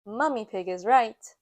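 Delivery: usual speaking voice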